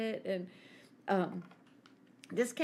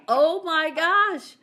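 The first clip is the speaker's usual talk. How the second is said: loud, high, stretched-out shock; shocked exclamation; 7 semitones higher, 9 dB louder, much slower than usual. Retold or imitated. Reacting to the kangaroo by the fence, loud drawn-out shock. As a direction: draw it out in scandalized amazement. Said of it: "I was like".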